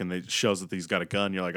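ordinary talk

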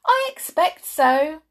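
high, loud gleeful answer; happily. Acted. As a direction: relish the bad news. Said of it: "said Missus Gabble happily".

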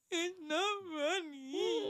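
slow, drawn-out fake sob; badly faked tearful protest; mock crying whine. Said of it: "And then when I'm like".

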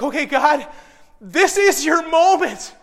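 high, loud plea, stretched out slowly; desperate prayer. Retold or imitated. Father on his knees begging God for a miracle, desperate. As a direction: beg urgently, driving each phrase home. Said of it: "I'm tossing up every prayer I know".

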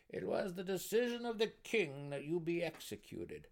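high-voiced gnome pronouncement; blunt. A narrator's reading. Gnome counselor announcing execution to the prisoner, blunt. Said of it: "the deep gnome said bluntly".

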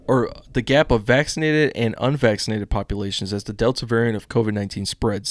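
ordinary talk, nothing marked

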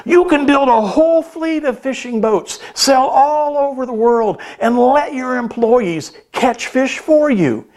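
like a man at the end of his rope; angry businessman. A narrator's reading. Businessman angry at the fisherman, exasperated high voice. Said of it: "the businessman was getting angry".

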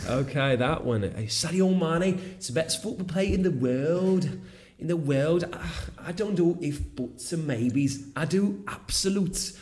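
excited football fan, high-pitched; Liverpool fan impression. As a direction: hype up your favourite player like a superfan. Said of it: "Fan del Liverpool, quello famosissimo". (tifoso del Liverpool esaltato, voce acuta)

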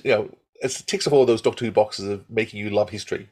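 normal talking voice

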